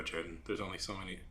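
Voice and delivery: normal talking voice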